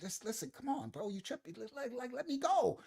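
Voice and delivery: hushed retold pleading, rising at the end; mock confidential whisper. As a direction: keep it very soft, urging someone privately. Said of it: "She's whispering something to security".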